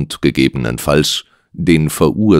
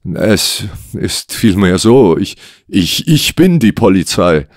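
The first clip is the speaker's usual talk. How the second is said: halting, slightly raised confession; stammering; 4 semitones higher than usual. Acted. Client admits his job to the detective, stammering. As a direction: hesitate and repeat into the admission. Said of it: "stammelte Lennox".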